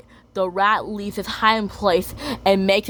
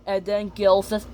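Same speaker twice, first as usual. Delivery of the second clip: usual speaking voice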